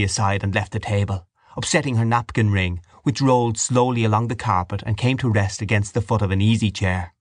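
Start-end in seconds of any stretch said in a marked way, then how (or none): none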